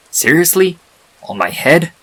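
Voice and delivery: can't believe she sleeps on your head; annoyed statue voice, loud; annoyed disbelief. Acted. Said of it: "the voice seemed annoyed".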